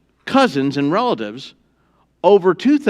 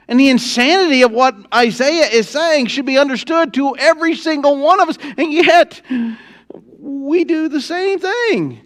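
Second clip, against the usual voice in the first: full-force preaching; 8 semitones higher than usual. Acted. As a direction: preach it hard to the church.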